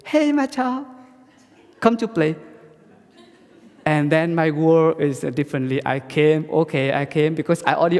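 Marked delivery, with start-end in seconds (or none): 0.1-2.3 put-on much higher, louder fond call of an old teacher; teacher's call. Teacher inviting his student to come play, much higher and louder. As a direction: call him in like a kindly master. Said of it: "So thầy".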